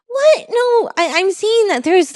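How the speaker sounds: high-pitched retold disbelief; incredulous protest. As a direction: jump up in pitch to object, baffled. Retells protesting to support.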